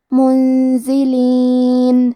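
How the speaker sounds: منزلین slow, the نون hidden with a nasal hum before ز; tajweed demo with ghunna. Shown as a demonstration. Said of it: "یہ حکم کو غنہ کے ساتھ ادا کریں گے". (منزلین میں نون کا اخفاء غنہ کے ساتھ)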